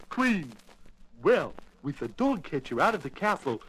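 usual speaking voice

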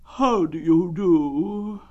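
slow, high mournful donkey voice; gloomy. Acted. Eeyore greeting Pooh in a gloomy manner. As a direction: greet him as if nothing could cheer you. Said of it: "in a gloomy manner to him".

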